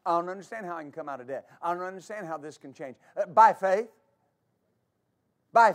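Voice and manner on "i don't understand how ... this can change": doubter's voice. Retold or imitated. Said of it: "Don't ever say".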